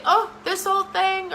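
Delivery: put-on high, slow self-deprecating "oh this old thing"; mock modest brush-off; dismissive deflection. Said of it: "don't say".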